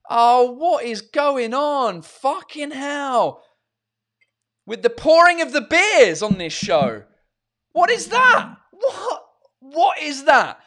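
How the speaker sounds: high, loud disbelieving cries; shocked. Real, not acted. Host watches his beer overflow, high shocked outcry. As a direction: react to the foaming beer.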